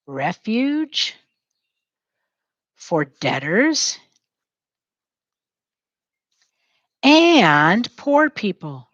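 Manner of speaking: "Refuge for debtors and poor people" drawn out slowly, phrase by phrase; slow it right down for the writers; slow dictation. Shown as a demonstration. Teacher dictating as students write, very slowly. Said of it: "Say it as you're writing it down".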